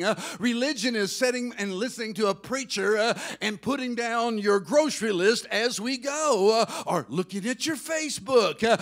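loud, swelling preacher's cadence; preaching at full force. Real, not acted. Preacher telling the congregation God wants them, loud and swelling. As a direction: drive the truth home to the pews.

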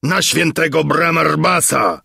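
high-pitched, roared oath; terrible roar. A narrator's reading. Armoured beard swearing as guards close in, terrible roar. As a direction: bellow the oath in a dreadful voice. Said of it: "ryknął okropnym głosem".

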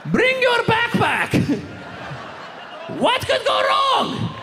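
high, slow, sing-song chirp; mock cheerful invite. Friend inviting Muhammad to the race, high slow sing-song. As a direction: chirp it innocently, far too high.

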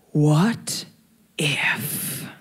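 low, breathy whisper of temptation; tempting whisper. Retold or imitated. The second voice in her head tempts her, low whisper. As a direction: whisper it like a devil on the shoulder. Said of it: "the second voice went".

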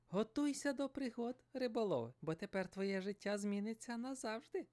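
high, mystical fish voice; magical fish voice. Acted. Enchanted fish to the fisherman, high and mystical. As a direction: speak as an enchanted fish promising adventure.

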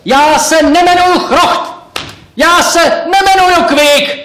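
high, loud protesting yell; loud shout. Acted. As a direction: shout it so they finally hear you. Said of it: "nahlas jsem křičel".

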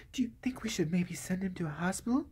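breathy mousy whisper, imitating the wife; mock timid whisper. Wife timidly suggests hospital, in a whisper. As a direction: whisper it like a meek housewife afraid to ask.

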